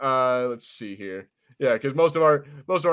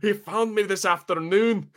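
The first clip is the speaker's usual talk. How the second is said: put-on higher, slow, over-emphasised Scottish accent; overdone Scottish accent; 7 semitones higher, slower than usual. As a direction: lean too hard on the Scottish accent. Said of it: "I put too much emphasis".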